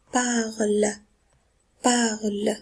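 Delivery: "parle" as one syllable, ending silent; silent -ent ending. Shown as a demonstration. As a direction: model "parle" carefully, stopping on the l. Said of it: "E N T se prononce E".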